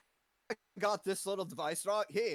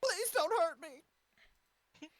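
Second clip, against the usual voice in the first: high, slow pleading granny; frightened old lady; 11 semitones higher, much slower than usual. Acted. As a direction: plead like a frail old woman.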